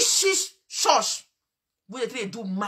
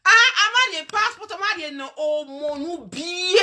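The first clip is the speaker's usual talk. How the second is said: high mocking taunt; 7 semitones higher, 5 dB louder than usual. Mock-singing taunt about the passport.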